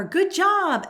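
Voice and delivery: high, bright praise; exclaiming. Shown as a demonstration. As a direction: cheer it with an exclamation mark. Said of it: "exclamation point".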